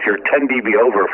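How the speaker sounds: usual speaking voice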